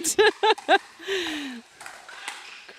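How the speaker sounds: high burst of real laughter; amazed laughter. Real, not acted. Commentator after a crazy point, laughing in amazement.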